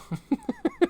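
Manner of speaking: high, soft laughing fit; high wheezing laughter. Real, not acted. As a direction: crack up quietly. Cracking up at the chat.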